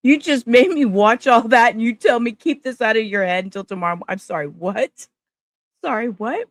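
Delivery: loud, high disbelief easing into a baffled question; incredulous protest. Real, not acted. Streamer reacting to judge telling jury to forget it, incredulous. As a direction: protest in laughing-stock disbelief, starting loud. Mock outrage at the judge's instruction.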